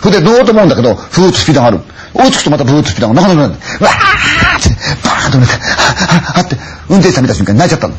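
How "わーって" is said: long high wail, slowed; wailing cry. Acted. Woman wailing as she reaches the taxi. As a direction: wail in terror. Bursting into tears.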